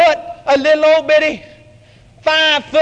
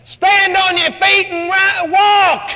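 higher, slow shouted order; loud command; 4 semitones higher, slower than usual. Acted. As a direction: command him to get up and walk. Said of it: "It's a command".

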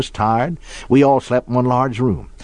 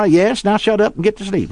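higher-pitched, fed-up order; mock exasperation; 7 semitones higher than usual. Father ordering his chattering son to sleep, mock exasperated. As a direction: act out a father at the end of his patience.

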